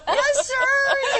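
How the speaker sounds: very high sweet puppet voice; polite little lamb voice; Lamb Chop voice. Pretend. Lamb Chop answering soldier.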